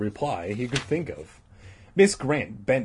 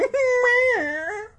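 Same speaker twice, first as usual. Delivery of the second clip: laughing; 4 dB louder than usual. Real, not acted. Narrator cracks up.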